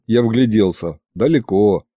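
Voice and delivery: usual speaking voice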